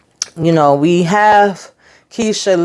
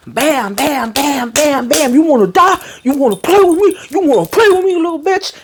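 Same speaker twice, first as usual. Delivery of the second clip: furious beatdown; 8 semitones higher than usual. Retold or imitated. Trig beating up the boy who smashed his truck, furious. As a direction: snarl the taunts while landing punches. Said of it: "He had the anger in his eyes".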